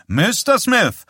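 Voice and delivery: high, blaring tuba-like call; brassy, booming hail; loud, booming. A narrator's reading. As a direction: trumpet the name across the room. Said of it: "mit lauter, dröhnender, tuba-ähnlicher Stimme".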